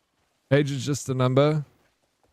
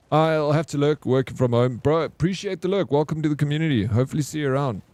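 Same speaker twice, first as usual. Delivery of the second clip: usual speaking voice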